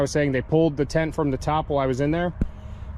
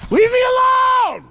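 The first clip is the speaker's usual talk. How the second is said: screaming; 8 dB louder, slower than usual. Real, not acted. Screaming at pursuers.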